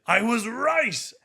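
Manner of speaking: high, drawn-out mock yell; crazed triumphant shout. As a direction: bellow it slowly like a crazed attacker. Crazed attacker acted out.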